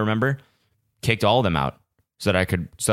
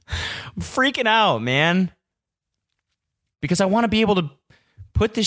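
freaking out; 12 semitones higher than usual. Real, not acted. Venting to listeners about a camera dying, high frantic voice. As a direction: let the stress spill out up high. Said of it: "I'm fucking freaking out".

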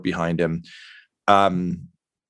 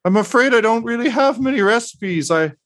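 higher and louder, a tired, rambling host; Mosifus Dirt, weary; 10 semitones higher, 3 dB louder than usual. Acted. Mosifus Dirt telling the party about the hard winter, weary and pitched up. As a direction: sigh through the winter woes, pitched up. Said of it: "at you all and says".

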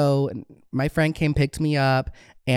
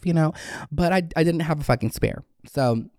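ordinary talk, nothing marked

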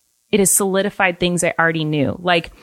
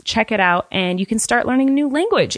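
ordinary talk

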